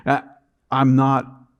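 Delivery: normal talking voice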